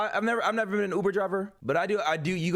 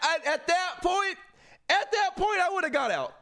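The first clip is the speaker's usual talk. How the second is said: outburst; 10 semitones higher than usual. Real, not acted. Outraged reaction to the story.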